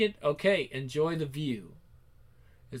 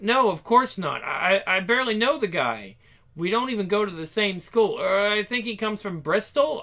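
nervous denial; 5 semitones higher, 6 dB louder than usual. Acted. Lying nervously to father.

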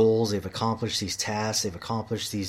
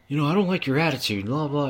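higher, hurried mimicry of a scolding; mocking foreman imitation; 5 semitones higher than usual. Foreman scolding worker over his attitude, mockingly imitated. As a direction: rattle off the boss's complaint mockingly, pitched up.

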